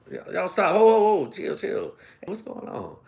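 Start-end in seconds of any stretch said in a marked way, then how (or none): none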